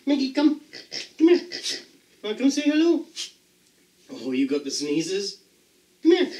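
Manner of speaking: very high, cooing pet voice; high pet-voice coaxing the dog; dog talk. Real, not acted. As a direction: coax the dog sweetly up high.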